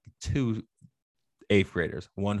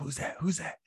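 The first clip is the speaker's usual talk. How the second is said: quick hushed whisper, pitched up; 6 semitones higher, 7 dB quieter than usual. Retold or imitated. Classmates whispering as mom walks into choir practice, hushed. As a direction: imitate kids whispering in a suddenly quiet room. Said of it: "then it got quiet".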